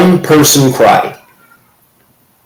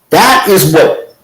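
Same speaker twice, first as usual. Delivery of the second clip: ordinary talk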